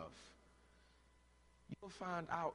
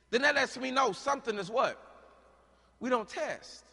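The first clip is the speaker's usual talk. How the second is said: loud, high, urgent sermon build; preaching at full force; 9 semitones higher, 13 dB louder than usual. Acted. Preacher challenging the church about rap and God's word, loud and rising. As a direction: press the point on the congregation.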